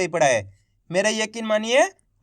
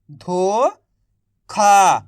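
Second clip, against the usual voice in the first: धोखा stretched slowly, sounding out the ओ vowel; slow drawn-out reading; 4 dB louder, much slower than usual. Shown as a demonstration. Teacher to young readers, sounding out धोखा slowly. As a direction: draw out धोखा slowly like a phonics drill. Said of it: "तो बताइए मिलके क्या बनेगा".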